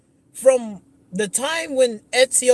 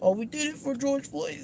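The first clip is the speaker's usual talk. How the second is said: high, soft mocking voice; mock-innocent, high and hushed; mocking quote; 6 semitones higher, 8 dB quieter than usual. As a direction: mimic a lame excuse, pitched up and quieter. Mocks the company's excuse.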